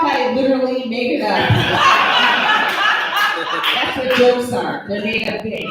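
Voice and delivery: ordinary delivery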